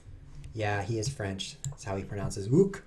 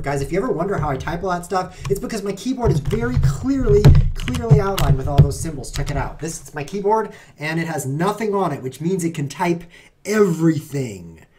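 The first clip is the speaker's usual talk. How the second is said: mock-proud showing off; 5 semitones higher, 8 dB louder than usual. Joking about his blank keyboard.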